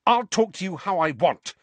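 high, heated defiant comeback; rising temper. A narrator's reading. Harry answers Seamus back, temper rising fast. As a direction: let the temper climb into the voice. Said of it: "his temper rising so fast".

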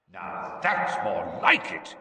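slow, satisfied growl. A narrator's reading. Teacher approving the boy's resistance, slow growl. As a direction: growl your approval, drawing it out. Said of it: "growled Moody's voice".

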